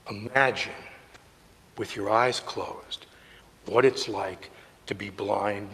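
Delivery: hushed and low, leaving space between phrases; hushed guiding. Real, not acted. Guiding listeners to imagine blindness.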